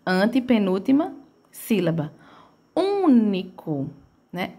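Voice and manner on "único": "Único" with a long, humming Ú; slow "Único", Ú drawn out; stretched first syllable. Shown as a demonstration. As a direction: hold the first syllable of "Único", then let NICO drop. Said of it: "ele tem esse som de um".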